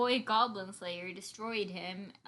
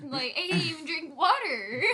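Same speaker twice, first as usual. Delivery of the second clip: laughing; 6 semitones higher, 4 dB louder than usual. Real, not acted. Laughing self-mockery.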